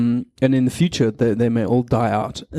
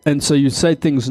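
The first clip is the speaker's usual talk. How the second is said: normal talking voice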